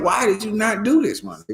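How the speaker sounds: pitched very high from WHY onward; asking inflection. Shown as a demonstration. Teacher acting the question for students, high-pitched WHY. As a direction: ask "WHY did you not" high and incredulous. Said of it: "why is a question".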